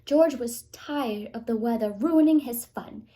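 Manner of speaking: ordinary delivery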